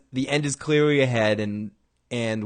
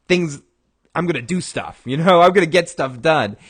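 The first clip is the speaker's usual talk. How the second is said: louder, higher, grumbling resolve; mock angry determination; 5 semitones higher, 6 dB louder than usual. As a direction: huff about getting things done. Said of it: "he's angry".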